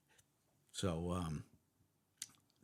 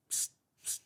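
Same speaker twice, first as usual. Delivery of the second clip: unvoiced hiss for attention; hushed psst. Cop signalling a SWAT officer at a barricade, hushed psst. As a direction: sneak a quick psst to someone nearby. Getting cop's attention again.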